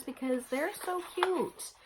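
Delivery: gushing. Real, not acted. Gushing about the needle minders.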